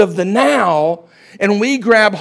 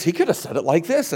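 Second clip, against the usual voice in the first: ordinary talk, nothing marked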